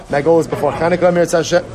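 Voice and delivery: ordinary talk, nothing marked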